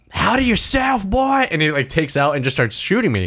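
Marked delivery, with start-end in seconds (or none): 0.1-1.5 hostile cowboy. Retold or imitated. Voicing hostile NPC cowboy.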